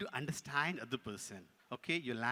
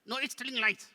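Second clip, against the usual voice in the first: higher, louder puppet retort; contradicting puppet; 9 semitones higher, 7 dB louder than usual. Pretend. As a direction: deny it hotly as the puppet.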